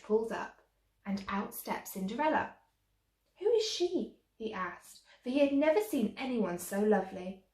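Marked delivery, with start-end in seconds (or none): 3.4-4.2 high, amazed question; wondering. A narrator's reading. Prince amazed at the lovely stranger, high wondering question. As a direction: ask in wonder, voice lifted high.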